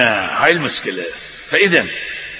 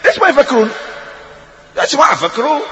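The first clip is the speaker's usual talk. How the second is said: raised, pleading call to think; urgent plea; 8 semitones higher, faster than usual. Real, not acted. Preacher urging the congregation to think, raised and pleading. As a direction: urge the crowd to wake up and think.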